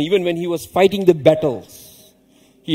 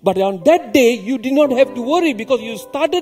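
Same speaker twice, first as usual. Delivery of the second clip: high-pitched, building sermon climax; fervent preaching; 7 semitones higher, 3 dB louder than usual. Real, not acted.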